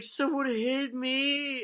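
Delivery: high, drawn-out imitation wail; mock crying. Imitating his brother crying after being hit in his sleep, wailing. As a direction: whine it slowly like a crying kid. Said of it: "you hear Jeremy crying".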